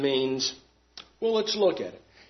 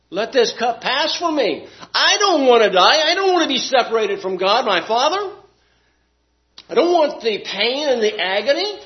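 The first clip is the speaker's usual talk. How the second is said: voicing Jesus' plea; 8 semitones higher, 4 dB louder than usual. Preacher voices Jesus praying in Gethsemane.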